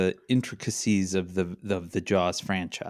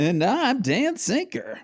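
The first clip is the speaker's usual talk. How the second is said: much higher, slow drawn-out name; hyped host intro; slower than usual. Real, not acted. Introducing himself on the podcast, slow high hype. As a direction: announce yourself like a game show host.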